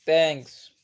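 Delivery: normal talking voice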